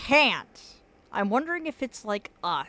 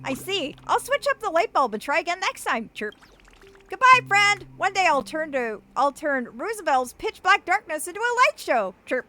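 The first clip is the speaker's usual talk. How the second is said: high, swooping cartoon birdie; chirpy little bird, pitched up and theatrical; origami bird voice; 7 semitones higher, 4 dB louder than usual. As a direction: squeak it out like a silly paper bird. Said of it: "That's the voice I give all the origami birds".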